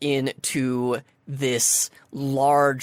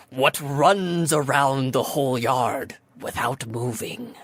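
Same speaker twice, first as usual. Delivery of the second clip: slow, solemn riddle delivery; formal riddle voice; slower than usual. Acted. Sphinx formally posing her riddle to the party, slow and solemn. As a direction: pose the riddle with mock gravity. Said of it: "she kind of straightens her back".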